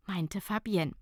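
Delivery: usual speaking voice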